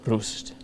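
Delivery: Rust said softly with an "oo" vowel; corrected Rust. Real, not acted. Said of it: "Okay, I did pronounce it wrong".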